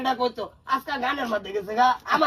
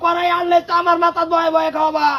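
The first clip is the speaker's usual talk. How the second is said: loud, raised-pitch scolding; heated, unbroken telling-off; angry retort; 2 semitones higher, 5 dB louder than usual. Acted. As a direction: throw the request back at full volume. Angry retort to marriage plea.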